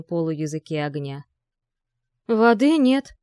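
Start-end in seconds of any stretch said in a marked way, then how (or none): 2.3-3.1 loud, high cry of alarm; alarmed shout. A narrator's reading. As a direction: shout it over the fire. Пожар, крик в тревоге. (тревожный крик)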